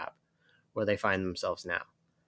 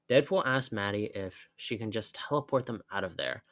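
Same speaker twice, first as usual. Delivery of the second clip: usual speaking voice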